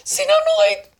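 high, loud acted cry; hateful outburst. Teacher voicing terrible hatred, high loud outburst. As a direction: blurt it out at full pitch and volume. Acting out hatred, then repeating it.

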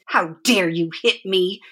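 usual speaking voice

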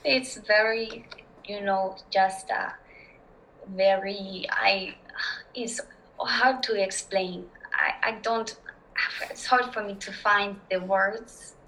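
halting, slow French accent; French accent, groping for each word; stuttering French accent. Shown as a demonstration. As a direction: stumble through it in a French accent. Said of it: "stutter my way through that".

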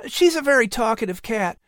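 put-on high boy's voice, grinning; boy showing off his pets; Patrick. A narrator's reading. Patrick, child voice.

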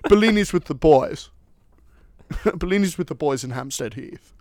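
loud, laughing mock British lad accent; jokey British accent. Co-host teasing his baffled friend with slang, jokey British accent. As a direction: crack up while hamming the British accent. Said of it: "like you're speaking another language".

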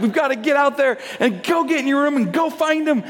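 frazzled urgency. Retold or imitated. Dad hurrying daughter out to soccer, acted frazzled urgency. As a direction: act out a parent rushing a child.

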